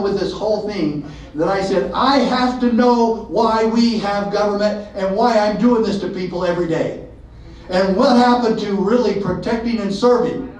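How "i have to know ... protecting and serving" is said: mild exasperated questioning. Retold or imitated. Officer questioning himself, rising exasperation. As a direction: throw the big questions up in frustration.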